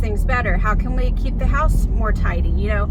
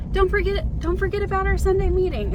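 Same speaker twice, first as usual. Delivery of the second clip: high, eager kid voice imitating her son; 9 semitones higher than usual. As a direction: mimic a keen little boy reminding mom. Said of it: "he was like".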